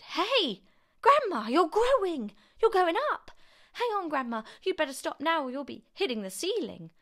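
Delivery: put-on high, loud boy's shout; startled shout. A narrator's reading. George sees grandma growing and jumps, startled shout. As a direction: shout up in high-pitched alarm. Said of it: "George gave a jump and shouted".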